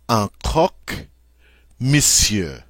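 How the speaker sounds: "Un croque monsieur" in clear French, "monsieur" as "mɪsjə"; teacher demo of French "croque monsieur"; French model word. Shown as a demonstration. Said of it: "Number two".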